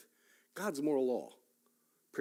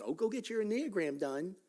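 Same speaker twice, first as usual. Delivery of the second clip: a light, higher mocking voice of worldly advice; 7 semitones higher than usual. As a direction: toss it off like trendy advice you scorn. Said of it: "give you personality tests".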